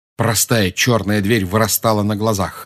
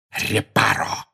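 hissing; slower than usual. A narrator's reading. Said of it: "прошипел Снег".

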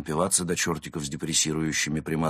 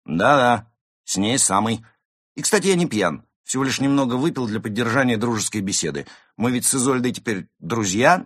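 higher, louder, self-important defence; boastful tipsy cat; 6 semitones higher, 6 dB louder than usual. Acted. Cat justifying his drinking to friends, boastful. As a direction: hold forth proudly to doubters.

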